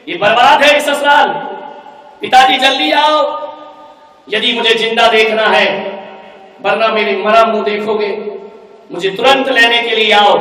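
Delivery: loud, high frantic demand; shouting threat to father; desperate angry bride. Acted. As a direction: yell down the phone for rescue. Daughter phones father, threatening.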